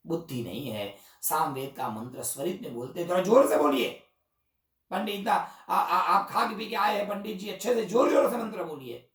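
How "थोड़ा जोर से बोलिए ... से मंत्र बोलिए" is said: loud, higher mocking scold; mocking rebuke. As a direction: scold the old priest to speak up. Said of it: "Devdutt नाम के ब्राह्मण को गुस्सा आया". (पंडित का ऊँची आवाज़ में ताना)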